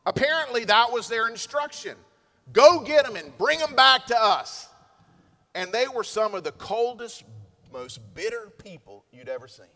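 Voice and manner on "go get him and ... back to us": barked order. Acted. Pharisees giving orders.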